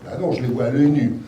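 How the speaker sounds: ordinary talk, nothing marked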